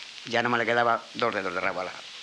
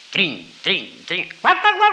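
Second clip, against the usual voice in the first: playful yips rising to high loud yelps; fox yelping; 8 semitones higher, 9 dB louder, much slower than usual. A narrator's reading. Fox frolicking on the rocks, playful rising yelps. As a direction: yap like a frolicking fox, climbing higher and louder.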